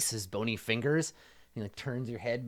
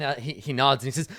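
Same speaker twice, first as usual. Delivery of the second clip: ordinary delivery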